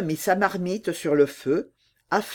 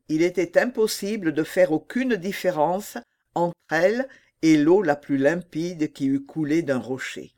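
ordinary delivery

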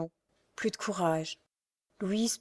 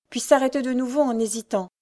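ordinary delivery, nothing marked

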